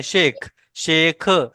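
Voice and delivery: overstressed शेख ending; wrong stressed way. Shown as a demonstration. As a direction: hammer the end of शेख, showing the mistake. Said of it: "ऐसे नहीं बोलना".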